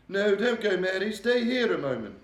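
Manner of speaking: high, loud, regal voice, shaken; a distressed queen confiding in her maid. A narrator's reading. The Queen telling Mary of her nightmare, regal and upset.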